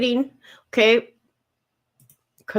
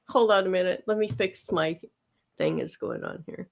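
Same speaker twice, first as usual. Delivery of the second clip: ordinary delivery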